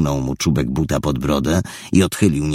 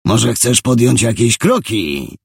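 higher, louder, taunting; provoking taunt; 7 semitones higher, 3 dB louder than usual. Acted. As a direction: egg him on again. Thug repeats taunt.

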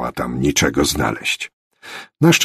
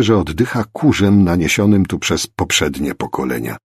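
plain reading voice

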